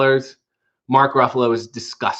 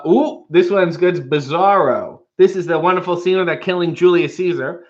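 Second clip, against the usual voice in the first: normal talking voice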